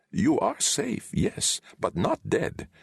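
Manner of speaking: a lightly voiced old wizard, gently correcting; a hint of a wise old sorcerer. A narrator's reading. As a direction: Deliamber speaks to Valentine in the dream. Wizard reassures him.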